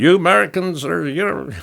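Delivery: lightly voiced hotel guest teasing; imitating the Greek guy. Hotel guest in the clown shirt ribbing Americans, high mock jab. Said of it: "in his way".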